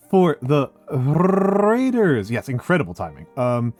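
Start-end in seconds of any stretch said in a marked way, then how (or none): 0.1-2.3 slow, drawn-out high chant; cute anime streamer. Pretend. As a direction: stretch it out like a rallying cry.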